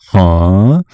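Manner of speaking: loud confused huh. Retold or imitated. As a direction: blurt it like a baffled crowd. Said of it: "the theater goes".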